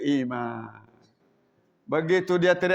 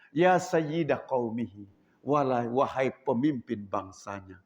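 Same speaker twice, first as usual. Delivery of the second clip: lower, soft and slow; gentle respectful address; 8 semitones lower, 3 dB quieter than usual. Acted. Mus'ab greeting the angry chief, gentle and soft. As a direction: speak softly with respect. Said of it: "dengan senyum dan lemah lembut".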